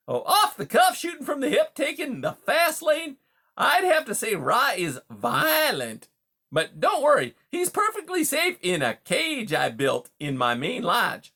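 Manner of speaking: high, loud, hearty salesman-like patter; brash, chummy beaver. Acted. Beaver greets a wary mouse as a new pal, brash and chummy. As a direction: play a pushy, overfamiliar beaver, loud and high.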